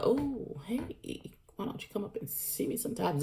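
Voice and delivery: lightly voiced flirty come-on, breathy-free and soft. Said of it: "she's steady giving the".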